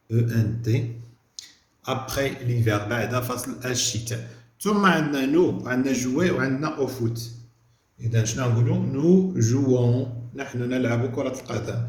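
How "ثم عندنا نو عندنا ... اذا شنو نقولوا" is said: ordinary talk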